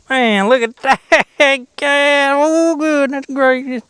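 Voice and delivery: high, slow, stretched-out goofy voice; silly put-on voice. An angler clowns for viewers over a fish, high and slow. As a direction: drawl it out like a cartoon yokel. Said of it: "I'm being silly".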